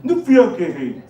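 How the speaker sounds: high, outraged protest; mock indignation at having to say sorry; indignant protest. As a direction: push back, offended he must apologise. Indignant retort in retold story.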